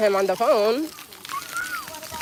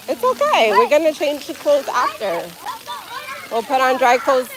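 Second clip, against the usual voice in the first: tender coaxing; 5 semitones higher than usual. Real, not acted. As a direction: gently lure her into the water, higher voice. Coaxing daughter into splash pad water.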